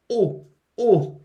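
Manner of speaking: "오" with a firm, stressed start; doubled-vowel onset. Shown as a demonstration. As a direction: press into "오" as if it began with a double consonant. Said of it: "이런 느낌으로 발음해주세요". (쌍이응처럼 힘준 '오')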